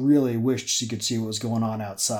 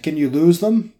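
raised, higher urgent question; louder; 5 semitones higher, 8 dB louder than usual. Acted. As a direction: call it out louder. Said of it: "More loudly, she said".

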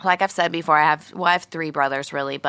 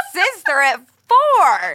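mock scolding; 10 semitones higher, 6 dB louder, much slower than usual.

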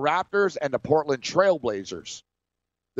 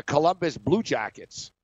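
usual speaking voice

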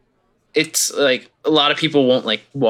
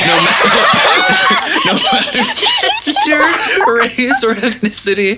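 laughing; 5 dB louder than usual. Real, not acted. Laughing through a joke line.

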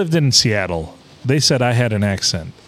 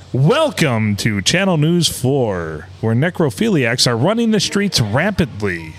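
slow, measured mock anchor greeting; news anchor. Topping a friend's fake newscast, slow mock anchor delivery. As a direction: intone absurd headlines like a TV newsreader. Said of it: "everybody on the news sounds just like I do".

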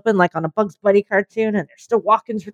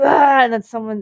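usual speaking voice

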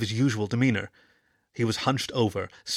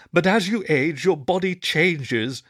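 put-on higher, slow old wolf; old wolf explaining; 5 semitones higher, 4 dB louder, slower than usual. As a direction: spell it out slowly to the pup. Wolford explains.

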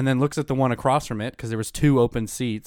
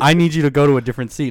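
fast, much louder retold demand; imitated angry order; 3 semitones higher, 9 dB louder, faster than usual. Stranger ordering passenger to another seat, mock angry and loud. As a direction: act out a pushy stranger ordering someone off.

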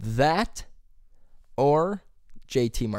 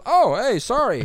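retold high, louder startled apology; sheepish apology; 11 semitones higher, 3 dB louder than usual. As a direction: act out dad caught off guard, pitched up. Dad's embarrassed apology, retold.

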